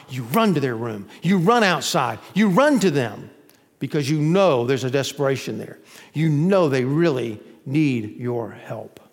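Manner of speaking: normal talking voice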